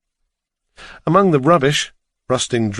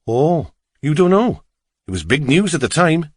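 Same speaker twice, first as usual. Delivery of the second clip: higher, singsong Welsh cabbie; Welsh accent; 4 semitones higher than usual. A narrator's reading. Driver starts revealing local tragedy, Welsh accent. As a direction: share local news in a Welsh lilt. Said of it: "his strong Welsh accent".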